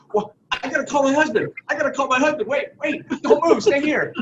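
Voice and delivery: retelling how Debbie panicked, mildly hammed-up; flustered Debbie. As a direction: imitate a flustered woman running for the phone. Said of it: "She said".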